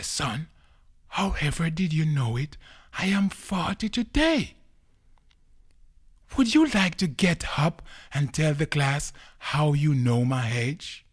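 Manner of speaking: high, loud astonished questioning; amazed inspector. Acted.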